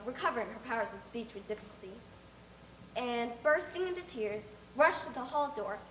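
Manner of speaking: plain reading voice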